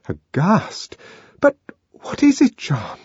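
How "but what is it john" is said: high, voiced stage whisper; small high frightened voice. A narrator's reading. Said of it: "she whispered".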